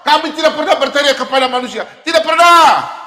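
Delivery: loud, insistent preacher's shout; a loud cry of denial from the pulpit; preaching shout. Real, not acted. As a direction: hammer it home to the congregation.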